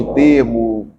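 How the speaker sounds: usual speaking voice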